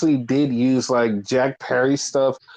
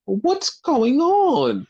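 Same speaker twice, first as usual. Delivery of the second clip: very slow, high, dopey question; mock bewildered voice; baffled; 10 semitones higher, much slower than usual. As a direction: drag it out, clueless. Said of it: "instead of being like".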